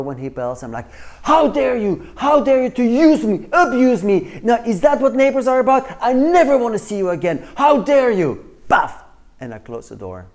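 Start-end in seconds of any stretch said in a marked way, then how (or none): 1.2-8.4 furious high-pitched shouting, full blast; aggressive. Acted. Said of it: "That's what we call aggressive behavior". 8.7-9.0 loud, explosive spoken slam sound; door-slam noise. Acted. Imitates slamming the door on neighbor.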